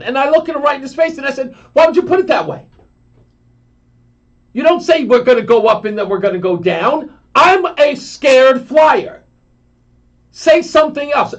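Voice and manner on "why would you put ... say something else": scared outburst. Retold or imitated. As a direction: snap back at the steward in fear. Scared flyer scolds steward.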